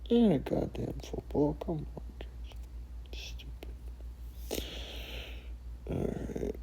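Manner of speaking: disgusted whisper. Real, not acted. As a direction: mutter it in disgust under your breath. Muttering after the outburst.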